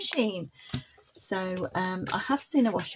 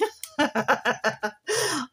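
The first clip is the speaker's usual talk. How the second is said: puppet giggle; 5 semitones higher than usual. Pretend. Puppet Polly laughs.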